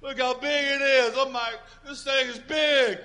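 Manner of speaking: hammed-up panic about the problem; fretting worrier. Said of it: "chasing my problem around, going".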